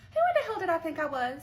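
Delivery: high, loud disbelief; loud, high-pitched self-mockery; incredulous outburst. Real, not acted. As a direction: cry out in amazement at yourself. Mocks her own past boldness.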